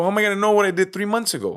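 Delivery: high, racing, incredulous question; worked-up, quick-fire disbelief; retold exasperated protest. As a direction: throw the absurd question back, reenacted.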